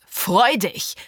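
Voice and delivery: sharp, bitter one-word lash; whiplash retort. Acted. Father scornfully throwing the doctor's word back, biting. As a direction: fling the word back at him. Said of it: "peitschte die Stimme meines Vaters".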